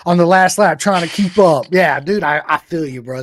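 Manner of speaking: loud, high, gleeful echo; excited teasing. Real, not acted. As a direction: rub it in playfully. Teasing echo of rival crashing.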